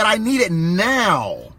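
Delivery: slow drawn-out now; desperate slow plea; pleading for now. Retold or imitated. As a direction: stretch out I need it now. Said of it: "he said".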